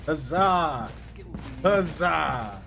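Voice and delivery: loud triumphant huzzah; excited cheer. Real, not acted. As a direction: shout it like a victory cry. Cheering the new squad.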